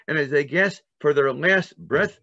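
ordinary delivery

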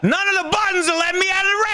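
high, fast frantic yell; panicking gamer. Real, not acted. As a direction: frantically shout at the controls. Panicking at controls.

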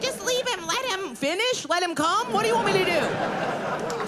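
high-pitched teacher at a loss; high, exasperated classroom voice. Retold or imitated. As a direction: plead helplessly like a defeated teacher.

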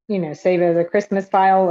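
ordinary talk, nothing marked

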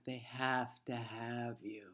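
slow, low whisper; hushed punchline. Real, not acted. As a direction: drop to a drawn-out, seductive whisper. Teasing punchline dropped to a whisper.

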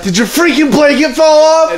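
high-pitched, exasperated mock yell; retold angry yell. Fed-up sibling yelling at little sister, mock-angry high yell. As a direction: threaten in hammed-up outrage. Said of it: "I was like".

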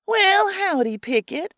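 bright, high, overly friendly greeting; sugar-sweet hello with a rise on the name; all friendly. Acted. Errol greeting Pickett to trick him, put-on friendliness. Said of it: "I say, all friendly".

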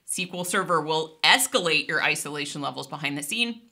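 ordinary talk

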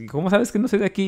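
high indignant how do you know; surprised question. Retold or imitated. Narrator asking the taco girl, high and surprised. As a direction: challenge her, caught off guard. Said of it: "Yo, pues".

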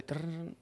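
soft rolled trill for a ringtone; imitated phone ring. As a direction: quietly trill like a ringing phone.

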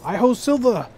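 high, ringing call to the horse. Acted. Calling horse in game.

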